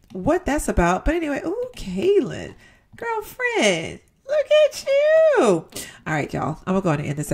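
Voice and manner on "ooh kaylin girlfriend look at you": high, drawn-out look at you; gushing admiration. Real, not acted. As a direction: admire her outfit with a squeal. Admiring her Sim's look.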